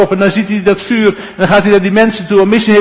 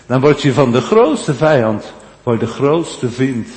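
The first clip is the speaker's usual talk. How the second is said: ordinary talk, nothing marked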